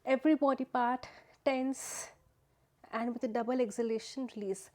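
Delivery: strained with tensing. Real, not acted. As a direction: push the words out through a tensed body. Instructing while tensing the whole body.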